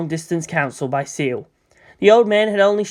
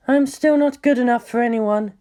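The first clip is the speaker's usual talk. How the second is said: inner thought; 7 semitones higher than usual. Acted. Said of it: "Ritsuko thought".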